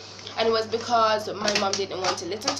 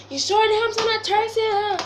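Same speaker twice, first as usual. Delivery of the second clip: high, loud, doubtful mom voice; mom doubting; 10 semitones higher, 5 dB louder than usual. Retold or imitated. Mimicking her mom questioning whether the hamster is thirsty, high and doubtful. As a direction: mimic mom's skeptical question.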